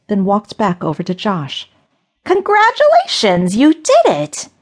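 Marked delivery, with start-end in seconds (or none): 2.2-4.5 much higher, louder cheer; gleeful. A narrator's reading. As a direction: burst with mock delight. Witch praises Josh gleefully.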